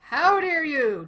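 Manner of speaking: loud outraged cry; shaking with anger. Real, not acted. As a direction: roar it in fury. Angry at game.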